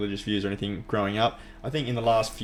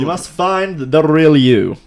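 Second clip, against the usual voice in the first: slow booming Alan Watts guru voice; Alan Watts impression; 6 semitones higher, 12 dB louder, slower than usual. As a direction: intone it like a wise old philosopher. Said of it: "that was my Alan Watts impersonation".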